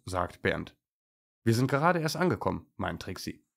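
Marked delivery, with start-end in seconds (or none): 1.4-2.7 quick, higher, bright answer; lightly excited, pitched up; eager reply. A narrator's reading. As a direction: chirp it out quickly. Eager young dog answers.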